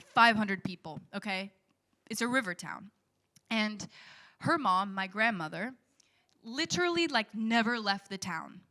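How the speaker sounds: normal talking voice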